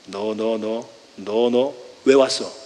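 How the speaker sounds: low, soft menacing growl of a father; a stern father, low and quiet, confronting his son. Acted. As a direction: stare him down, low and cold. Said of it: "이렇게 해야 되거든요".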